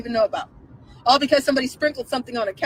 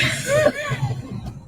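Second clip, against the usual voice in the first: high shouted laugh; 13 semitones higher than usual. Real, not acted. Vlogger bursts into high shouted laughter.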